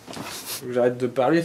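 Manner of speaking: ordinary talk, nothing marked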